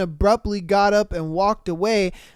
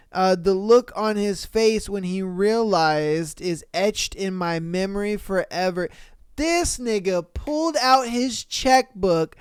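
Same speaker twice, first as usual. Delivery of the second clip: ordinary delivery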